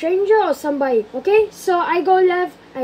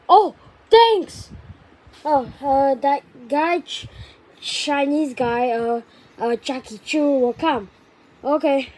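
ordinary talk, nothing marked

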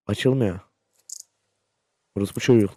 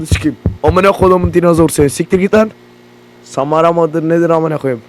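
loud, higher angry yelling; furious shout; 3 semitones higher, 7 dB louder than usual. Real, not acted. Gamer shouting at the dinosaur that jumped him, furious. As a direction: scream him off like he means it.

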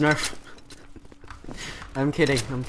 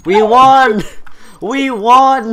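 loud, high victory cheer; excited shout; 7 semitones higher, 7 dB louder, much slower than usual. Real, not acted. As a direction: yell it out in triumph. Victory celebration.

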